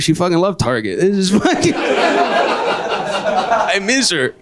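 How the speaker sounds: milk the fake mourning, cracking up; hammed-up grieving, slowing to a crawl; mock grief. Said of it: "I was like".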